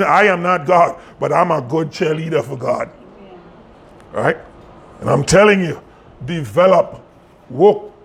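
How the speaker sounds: usual speaking voice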